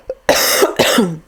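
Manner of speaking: a short dry cough. Real, not acted. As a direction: clear the throat with a cough. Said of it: "while I just have a little cough".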